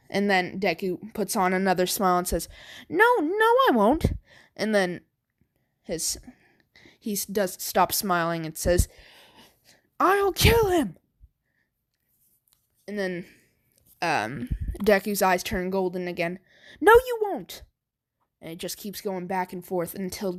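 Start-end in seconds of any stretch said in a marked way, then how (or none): 2.9-4.1 high, eager protest; higher good-side voice. Acted. Good side refuses, arguing inside. 10.0-11.0 high, pushy insistence; the other self argues in a high voice; higher insistent voice. Acted. Split personality arguing. 16.8-17.6 high, loud forbidding; high firm refusal. Acted. Split personality arguing.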